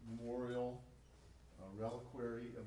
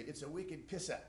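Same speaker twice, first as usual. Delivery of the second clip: Boston accent, R dropped, pitched up; higher-pitched Boston twang without the final R; 4 semitones higher than usual. Shown as a demonstration. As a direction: do a broad Boston pissa, open A ending. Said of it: "That's a Boston accent".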